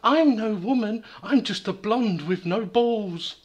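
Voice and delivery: high, put-on character voice; K-Mag. Co-host stepping in to voice the blonde, high voice. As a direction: raise the pitch right up and protest. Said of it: "you do K-Mag".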